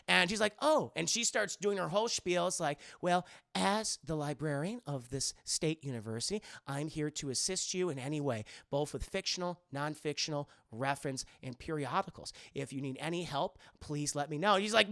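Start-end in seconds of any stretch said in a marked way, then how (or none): 0.6-0.9 higher surprised oh; lightly acted start of surprise; startled librarian. Startled librarian notices the student. 3.0-14.5 low, softer official recital; prim librarian's spiel. Retold or imitated.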